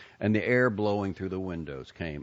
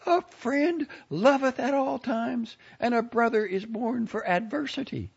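bellowing; 7 dB louder than usual. A narrator's reading. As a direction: bellow it to the whole bus. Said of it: "bellowed for all to hear".

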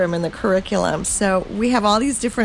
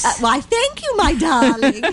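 much higher, gracious granny voice; Mother Goose voice; 4 semitones higher than usual. Pretend. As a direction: gush like a doting storybook granny. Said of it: "Mother Goose".

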